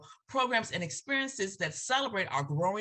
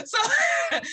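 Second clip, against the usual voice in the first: burst of laughter; 8 dB louder than usual. Real, not acted. Laughing at own Southern joke.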